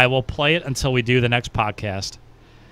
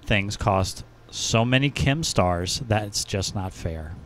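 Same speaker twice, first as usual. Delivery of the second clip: reading the review slowly, stressing each word; slow emphatic reading; 3 semitones lower, 6 dB quieter, much slower than usual. Retold or imitated. Podcast host reads a pug's all-caps complaint, slow and emphatic. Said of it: "so I have to say it like that".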